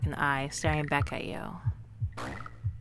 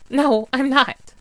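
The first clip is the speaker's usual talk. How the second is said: higher, louder, drawn-out protest; loud slow denial; 8 semitones higher, 8 dB louder, slower than usual. Acted. Accused of cheating, loud slow denial. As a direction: plant your feet and deny it.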